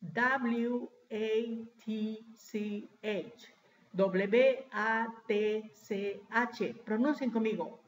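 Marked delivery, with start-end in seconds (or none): 0.0-3.5 very slow letter-by-letter dictation; spelling out slowly. Shown as a demonstration. Teacher spelling watch for class, slowly letter by letter. 3.9-6.7 slow Spanish letter names; spelling out slowly. Shown as a demonstration. Teacher respelling watch in Spanish, slowly letter by letter.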